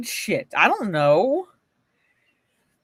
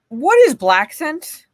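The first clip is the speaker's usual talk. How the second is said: drawn-out, much higher disbelieving question; incredulous; 10 semitones higher, 5 dB louder, slower than usual. Real, not acted. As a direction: stretch it out, baffled and high.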